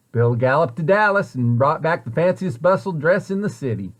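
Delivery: ordinary delivery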